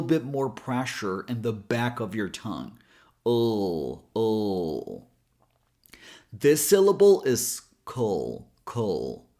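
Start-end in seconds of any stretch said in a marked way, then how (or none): 3.2-5.0 "Oh" as a held dark ull; dark L sound. Shown as a demonstration. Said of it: "And a dark L sound". 8.6-9.3 "kol" as K into dark L; low, isolated "kol" with barely any vowel; syllable demo. Shown as a demonstration. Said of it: "adding a schwa sound between K and L".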